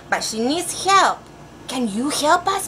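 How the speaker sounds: ordinary delivery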